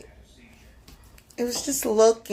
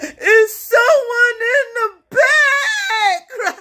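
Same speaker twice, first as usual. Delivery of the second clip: very slow, much higher, louder, laughing-crying yell; desperate warning shout; 13 semitones higher, 7 dB louder, much slower than usual. Retold or imitated. Attendant screaming after the girl driving off, high slow yell.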